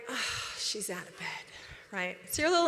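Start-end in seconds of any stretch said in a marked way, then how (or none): 0.1-1.5 annoyed aside. Retold or imitated. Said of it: "And you're like".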